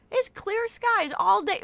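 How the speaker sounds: high, loud, slow mocking parrot; mock the app's cheery forecast; mocking the weather app. Quoting the weather app while it pours, high mocking voice. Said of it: "my fucking weather app still says sunny all day".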